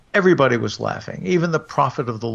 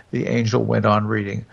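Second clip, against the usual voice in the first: ordinary delivery, nothing marked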